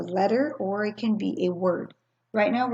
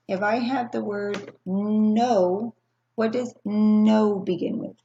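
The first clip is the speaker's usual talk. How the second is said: put-on slow, loud, drawn-out "no" for children; slow teacher prompt; 5 dB louder, slower than usual. Shown as a demonstration. Teacher quizzing young kids on sounds, slow and loud. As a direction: stretch each word for young learners. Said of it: "give me the initial sound".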